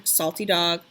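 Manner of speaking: plain reading voice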